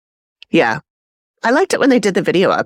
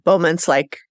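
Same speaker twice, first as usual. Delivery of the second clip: ordinary delivery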